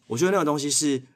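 ordinary talk, nothing marked

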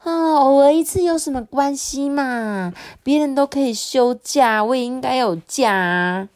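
cutesy cajoling voice; wheedling, coquettish pleading. A narrator's reading. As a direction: whine sweetly to get your way. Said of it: "拉着妈妈的手撒娇".

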